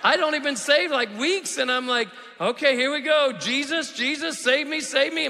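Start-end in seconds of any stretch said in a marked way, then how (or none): 2.4-5.3 loud, high improvised plea; panicked novice prayer. Acted. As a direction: blurt out a prayer, comically out of your depth.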